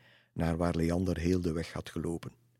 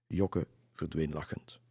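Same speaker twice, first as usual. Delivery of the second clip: ordinary delivery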